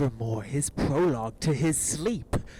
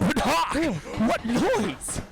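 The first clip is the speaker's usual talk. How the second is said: alarmed shout; 11 semitones higher, 5 dB louder, slower than usual. Acted. Alarm at the brawl.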